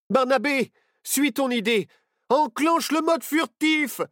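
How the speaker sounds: loud high urgent yell. A narrator's reading. Nao yelling to Barnabé as ghosts attack, loud. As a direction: scream the order. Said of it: "hurla Nao".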